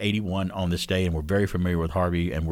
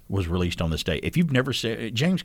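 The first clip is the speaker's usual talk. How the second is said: ordinary delivery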